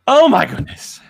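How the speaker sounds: loud, high exclamation of amazement; awed outburst. Real, not acted. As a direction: burst out, overwhelmed by the moment. Reacting to the episode's ending.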